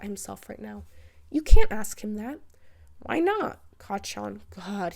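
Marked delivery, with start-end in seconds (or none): 1.3-2.4 much louder scolding shout; mother scolding her rude son. A narrator's reading. 3.0-3.6 put-on bratty boy. A narrator's reading. Boy protests.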